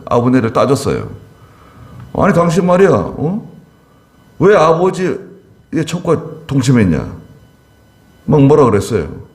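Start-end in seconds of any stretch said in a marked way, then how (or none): 2.1-7.1 higher-pitched, reproachful scolding; mock rebuke. King confronting Abner over the concubine, mock angry scolding. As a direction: demand an answer, playing the offended king. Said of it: "그 막 책망을 했어요".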